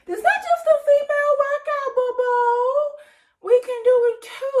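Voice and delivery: high-pitched, sassy cheer; playful cheer. Real, not acted. Playful cheer about the workout.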